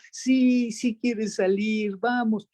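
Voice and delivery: put-on high, slow, cheerful baby-talk answer; mother answering for baby. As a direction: answer on the baby's behalf, beaming. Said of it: "La mamá tomaba los dos turnos".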